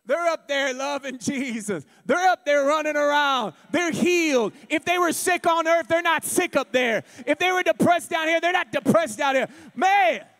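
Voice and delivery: loud, high joyful preacher; preaching at full force. Real, not acted. Preacher rejoicing that the dead are healed in heaven, shouting. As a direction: shout the good news of heaven.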